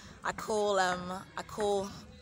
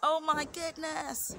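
high, slow wail of dismay; acted-out horror, very stretched and high; retold alarm; 9 semitones higher, slower than usual. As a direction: draw it out high, reliving the shock. Replays her reaction to the crash.

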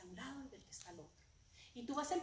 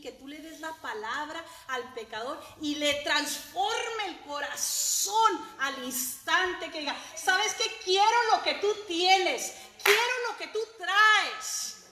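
rising from firm to high, loud and slow; preaching building up; 6 semitones higher, 13 dB louder, slower than usual. Acted. Preacher voicing the sinner's cry, building to loud preaching. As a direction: climb into a fiery plea.

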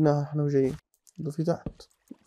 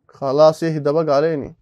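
exasperated plea; 8 dB louder, slower than usual. Real, not acted. Pleading with a mob.

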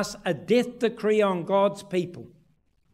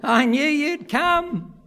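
put-on slow, higher child's line; voicing his son; 6 semitones higher, slower than usual. Son hugging his father, slow and higher. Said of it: "he kept saying to himself".

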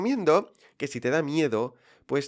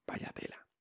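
breathy whisper of dismay; 16 dB quieter than usual. Retold or imitated. To himself after struggling with English speakers, whispered. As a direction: whisper it under your breath, overwhelmed. Retold dismay talking to Londoners.

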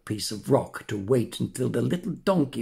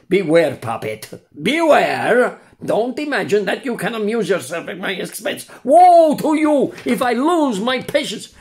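louder and higher, rising menace; threatening warning; 9 semitones higher, 7 dB louder than usual. Acted. The buyer threatens the puppet, building to a loud warning. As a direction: warn him with growing anger.

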